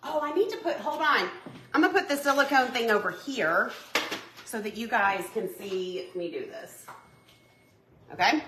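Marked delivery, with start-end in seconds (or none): none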